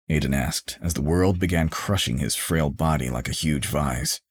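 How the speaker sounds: ordinary delivery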